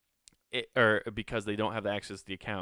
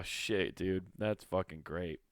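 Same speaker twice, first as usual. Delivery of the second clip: ordinary talk, nothing marked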